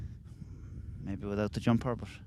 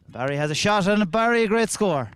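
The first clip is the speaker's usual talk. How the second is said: excited commentary; 8 semitones higher, 6 dB louder than usual. Real, not acted. Excited goal call.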